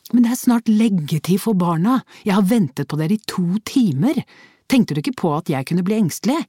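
husband scolding wife about bedtime, worried anger. A narrator's reading.

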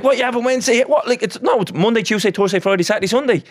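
mock convincing confidence. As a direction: insist on it as if utterly certain. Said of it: "be convincing about it".

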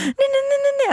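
very high, rapid-fire mock protest; imitated panic. Mother imitating her daughter guarding a toy, panicky. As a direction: squeal it like a panicked child. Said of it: "hon får ju panik".

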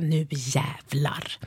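slow whisper to herself, scheming; whispered resolve. Retold or imitated. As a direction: breathe it out like a secret plan. Thinks on seeing Pia Sundhage. (viskar långsamt, beslutsamt)

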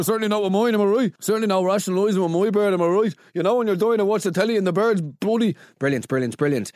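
hammy, quick-fire bad-joke voice; mock Facebook comic. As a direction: parody a hack comic fishing for agreement. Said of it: "Facebook comedy".

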